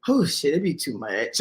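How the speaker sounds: usual speaking voice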